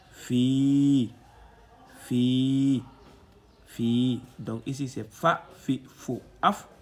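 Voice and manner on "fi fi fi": each "fi" loud, with a long held i; lengthened vowel. Shown as a demonstration. Teacher drilling the long i for learners, drawn-out vowel. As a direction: repeat "fi", stretching the i every time. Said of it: "l'outil d'allongement de la voyelle i".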